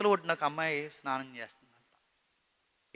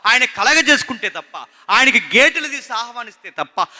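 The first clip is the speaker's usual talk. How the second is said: high, loud rising appeal to the church; full-force preaching; 5 semitones higher, 7 dB louder than usual. Acted. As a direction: hammer the point at the congregation.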